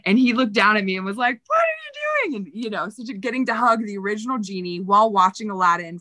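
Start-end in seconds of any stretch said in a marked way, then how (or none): 1.5-2.5 high-pitched incredulous question; mock astonishment. Actor finds a friend lying on the bridge, astonished. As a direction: squeal it in delighted surprise. Retold friend surprised to find her.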